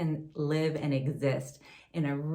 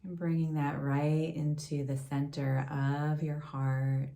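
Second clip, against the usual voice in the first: calm, unhurried meditation voice with long pauses; guided meditation. Acted. Yoga teacher leading a gratitude meditation for listeners, guided meditation. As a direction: gently guide them inward, leaving space between phrases.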